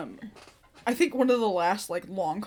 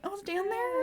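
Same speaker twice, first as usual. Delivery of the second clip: nonchalant; 11 semitones higher, 5 dB quieter than usual. Retold or imitated. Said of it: "just nonchalantly being like".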